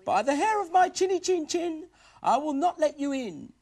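very high piggy voice; little pig voice. Acted. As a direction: squeak defiantly like a little pig.

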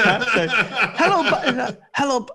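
mock Italian accent, laughing through it; half-laughing imitation of an Italian greeting; Italian accent impression. Teammate mimicking Paolo's Italian greeting, laughing. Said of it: "he's in his accent".

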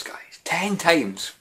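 breathless whispered repeat of Ten times; whispered aside. Shown as a demonstration. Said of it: "Okay, ten times".

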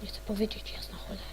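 hushed curse under the breath; whispered curse. Real, not acted. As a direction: swear in a whisper so you're not found. Hiding from mob, cursing. (przekleństwo szeptem)

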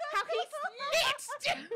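high, loud, drawn-out cry; indignant outburst. Real, not acted. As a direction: shout it slowly in laughing outrage. Mock outrage at friend snitching.

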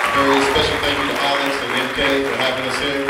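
loud, fast announcer thanks. Acted. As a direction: announce it over the music. Loud closing thanks to crowd.

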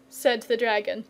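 usual speaking voice

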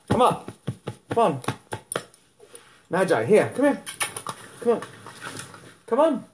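high, coaxing calls to the dog. Real, not acted. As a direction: coax the puppy up. Coaxing dog onto bed.

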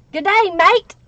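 cheery Australian "Good day, mate"; a playful stab at an Australian greeting; hammed-up Aussie hello; Australian accent. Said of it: "I love Australia's accents".

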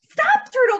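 high, loud storybook shout; cried out. A narrator's reading. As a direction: call urgently to stop someone. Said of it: "cried Skunk".

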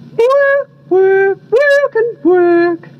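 high, drawn-out magpie squawk. A narrator's reading. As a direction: squawk the word slowly like a magpie. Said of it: "said the magpies".

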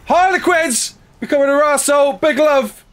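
high, fast, rousing cheer; excited shout-out. Real, not acted. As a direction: call out a big hearty welcome. Greets a supporter with shouted cheer.

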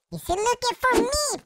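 normal talking voice

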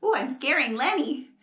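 ordinary delivery, nothing marked

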